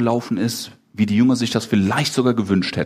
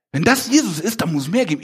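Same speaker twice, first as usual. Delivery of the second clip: raised pitch, urgent acted longing; eager yearning; 6 semitones higher, faster than usual. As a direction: voice a follower hungry for more. Second, yearning take of Peter.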